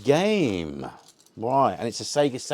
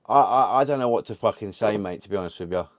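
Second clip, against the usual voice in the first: ordinary talk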